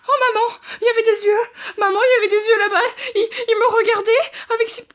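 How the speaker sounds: high, loud, panicked wail; real panic. Real, not acted. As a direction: cry out to mum in fright. Panics after jump scare.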